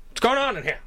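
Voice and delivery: high, loud 1930s beat cop; old-timey cop. Retold or imitated. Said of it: "old-timey cop".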